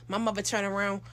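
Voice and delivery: usual speaking voice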